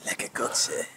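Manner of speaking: whispered, queasy. Real, not acted. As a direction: whisper it feeling sick.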